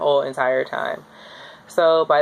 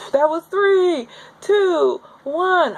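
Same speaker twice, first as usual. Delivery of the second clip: strained countdown; 9 semitones higher than usual. Retold or imitated. Couple counting down together through the worst of a contraction, high and strained.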